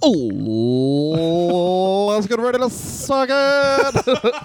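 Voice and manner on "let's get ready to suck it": high, slow drawn-out announcer call; ring announcer voice. Retold or imitated. Mock ring announcer parody.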